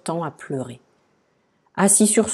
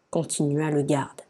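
plain reading voice

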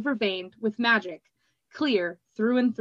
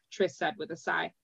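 usual speaking voice

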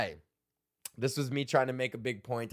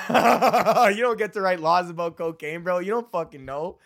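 cracking himself up mid-rant; laughing while ranting; 4 semitones higher, 7 dB louder than usual. Real, not acted. Laughing at his own old bit while ranting.

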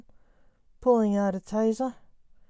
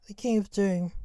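usual speaking voice

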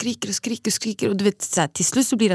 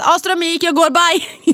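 high, loud dramatic bail-out; hammed-up cry of giving up; mock exasperated exit; 9 semitones higher, 5 dB louder, slower than usual. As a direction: act out throwing in the towel. Acts out giving up and leaving.

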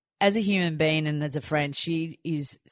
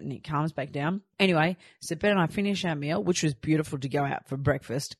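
ordinary talk

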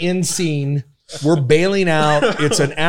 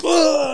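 mock groan; 13 semitones higher than usual. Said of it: "a cameraman drops".

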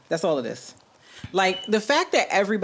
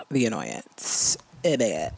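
exasperated; 7 semitones lower, 3 dB quieter, slower than usual. Acted. Exasperated low aside.

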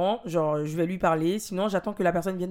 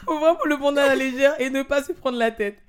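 recovering from laughter; 6 semitones higher, 4 dB louder than usual. Real, not acted. Reading on after laughing fit.

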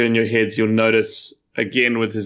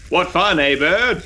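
raised-pitch jaunty call; 7 semitones higher than usual. Acted. Jeffrey calls over his shoulder to the bird, calling out. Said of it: "Jeffrey calls".

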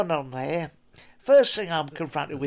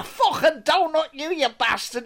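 higher, angry mock snarl; mock baker rant; 8 semitones higher than usual. Baker sneering at the guest's question, mock anger. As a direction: spit it out in disgust. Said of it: "He's gone".

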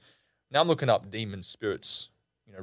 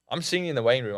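ordinary talk, nothing marked

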